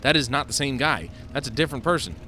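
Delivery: loud, irritated gamer complaint; loud exasperation. Real, not acted. Player fed up with others crowding in, exasperated. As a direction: complain loudly at people in the way.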